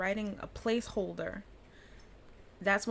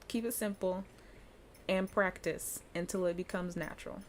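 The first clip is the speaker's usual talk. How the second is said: ordinary talk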